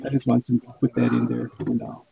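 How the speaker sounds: ordinary delivery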